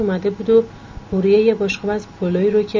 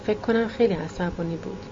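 usual speaking voice

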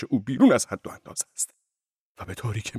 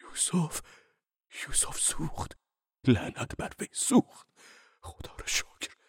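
hushed, softer, nearly breathless confession; quiet and calm; 5 semitones higher, 9 dB quieter, slower than usual. A narrator's reading. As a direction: tell it like a secret. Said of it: "با صدای آهسته و آرام گفت". (آهسته و آرام، تقریباً نجوا)

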